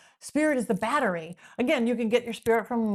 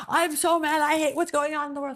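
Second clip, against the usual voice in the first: mock angry rant; 5 semitones higher than usual.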